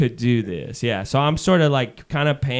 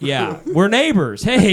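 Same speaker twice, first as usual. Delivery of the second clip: mock cheery greeting; 6 semitones higher, 6 dB louder, slower than usual. Mocking cheery neighborly greeting.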